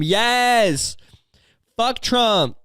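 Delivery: high, drawn-out crowd cheer; mock cheer. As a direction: imitate an overexcited rally fan. Imitates girls cheering against Trump.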